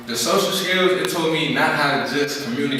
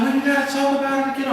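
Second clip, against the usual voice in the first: much higher, fast nagging mom voice; insistent mother; faster than usual. Retold or imitated. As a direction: push the kid to open up. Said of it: "She's like".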